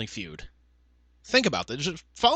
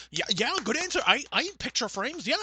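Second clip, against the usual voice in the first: high-pitched mock encouragement; cheering family member; 9 semitones higher than usual. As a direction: egg them on like a gullible contestant's family. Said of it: "family members ... just going like".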